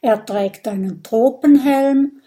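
ordinary delivery, nothing marked

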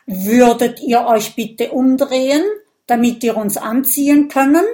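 loud request with rising ends; pointed request. A narrator's reading. Viking leader asks friends to turn round, pointed request. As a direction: ask insistently, voice climbing.

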